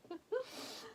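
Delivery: light high giggle. Real, not acted. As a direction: giggle softly. Giggling at the story.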